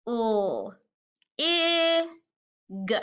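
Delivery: "O, I, g" as separate l, ih, g; sounded out. Shown as a demonstration. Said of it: "One more time".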